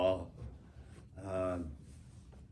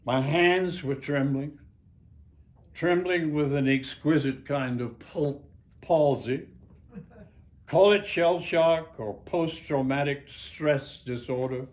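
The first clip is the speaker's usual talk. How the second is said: memoir reading; 4 semitones higher, 7 dB louder than usual. Acted. Author reads his memoir aloud.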